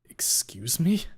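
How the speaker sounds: breathless, voiceless whisper of disbelief; stunned whisper. Acted. Narrator whispering in shock at Reed's news. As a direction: whisper it as if the air got knocked out.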